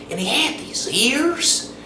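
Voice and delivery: ordinary talk, nothing marked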